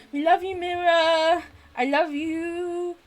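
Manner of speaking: high, slow, drawn-out cooing; singsong, teasing sweetness; playful affection. Pretend. Affectionate reassurance after teasing.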